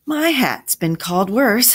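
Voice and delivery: loud, high comic protest; shouting. A narrator's reading. Jack answers a remark about his hat, shouting. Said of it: "shouted Jack".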